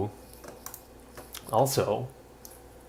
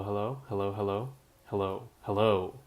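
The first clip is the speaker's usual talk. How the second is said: ordinary talk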